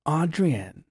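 lilting, drawn-out teasing of the name; a playful chef teasing a contestant; teasing Gordon voice. Retold or imitated. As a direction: sing the name the way Gordon teased it. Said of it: "he said my name funny".

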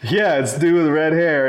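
ordinary talk, nothing marked

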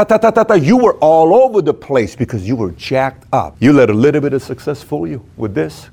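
ordinary talk, nothing marked

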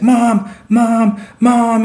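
high, loud retold wail for a mother; crying out for mom. Storyteller imitates his buddy waking up and crying, high wailing call. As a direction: imitate a distressed kid calling out, high and loud. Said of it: "and he's just crying".

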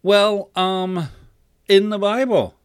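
put-on high, loud, halting boy; stammering. A narrator's reading. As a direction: grope for an answer as a flustered kid. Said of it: "stammers Jimmy".

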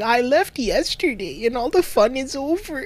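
high, slow mock sobbing snail voice; mock crying snail. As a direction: whimper it like a heartbroken little snail. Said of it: "the snail's, like, crying".

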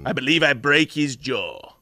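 slow movie-villain line in a mock South African accent; imitated South African accent. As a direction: drag it out, imitating a shaky attempt at South African. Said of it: "he's trying to do South African".

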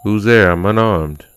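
nervous, calling into the dark; scared calling out. Acted. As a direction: call out, trying to sound brave. Alone in dark woods, calling out.

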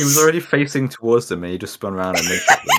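half-laughing the whole line out. Real, not acted. Telling a friend about the silly spin, laughing. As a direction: chuckle through it in disbelief.

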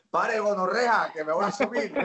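high, laughing Medellín accent, hammed up; mock Medellín slang. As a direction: throw it at the bus driver with a Medellín swagger, half laughing. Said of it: "nadie le dice al señor del bus".

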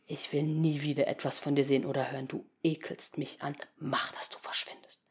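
quiet contempt. Acted.

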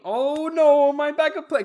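high, loud, drawn-out dismay; hammed-up cry of alarm; mock panic. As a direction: wail it as the plan collapses. Acted dismay as the plan fails.

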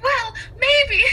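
high, loud, laughing coy maybe; teasing princess. Retold or imitated. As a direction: play hard to get through a laugh.

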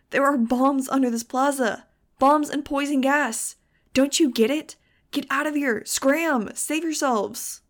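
high, loud, desperate warning; frantic bellow. A narrator's reading. Narrator begging a doomed crowd to run, frantic bellow. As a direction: plead at full voice for them to flee. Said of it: "I bellowed at them, frantic".

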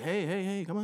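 high, cajoling movie-star voice; playful mock Tom Cruise pitch. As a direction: coax her like a charming film star. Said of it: "what if he was like".

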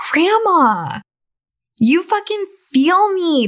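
hammed-up sarcastic yes; sarcastic reply. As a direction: gush fake enthusiasm at grandma.

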